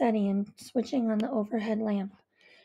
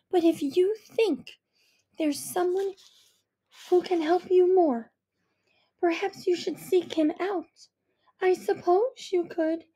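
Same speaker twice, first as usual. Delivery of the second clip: hesitant mother; 6 semitones higher than usual. A narrator's reading. Mother hesitantly suggesting her daughter seek him out. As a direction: suggest it carefully, unwilling. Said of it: "mother suggests seeking father".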